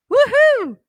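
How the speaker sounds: high, loud cheer; joyful whoop. Retold or imitated. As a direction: let out a happy whoop. Celebrating son's return.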